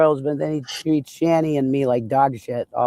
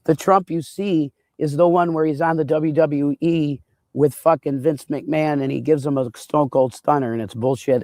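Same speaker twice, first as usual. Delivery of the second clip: ordinary delivery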